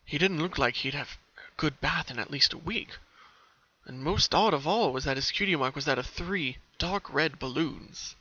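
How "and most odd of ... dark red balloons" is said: usual speaking voice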